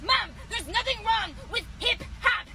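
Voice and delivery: ordinary talk, nothing marked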